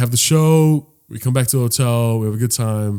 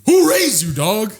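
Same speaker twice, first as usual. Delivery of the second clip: high, loud, disbelieving; outraged jab; 9 semitones higher, 4 dB louder than usual. Real, not acted. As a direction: yell it at him like he has no manners. Outraged punchline.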